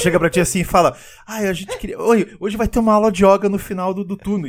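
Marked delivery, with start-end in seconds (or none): 1.3-4.5 higher-pitched pestering coworker; mock chatty colleague, pitched up; annoying office person. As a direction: play the office pest asking a favour. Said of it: "aquela pessoa chata do escritório".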